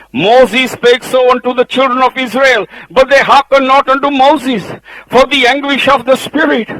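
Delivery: full-voiced sermon delivery; preaching at full force. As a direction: proclaim it like a pulpit preacher.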